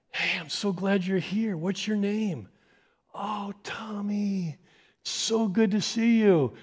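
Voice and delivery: put-on beam at the child as you speak; smiling welcome. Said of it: "with smiles on their face saying".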